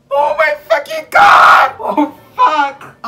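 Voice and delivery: yelling in horror at the twist; shocked shout. Real, not acted. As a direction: scream it out, appalled. Screaming at story twist.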